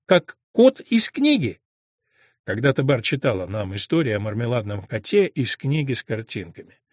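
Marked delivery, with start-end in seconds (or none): 0.0-1.6 high-pitched, louder, drawn-out cry of recognition; excited exclamation. A narrator's reading. Bar recalls the cat from the book, exclaiming excitedly. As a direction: exclaim it slowly, voice soaring. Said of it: "воскликнула Бар".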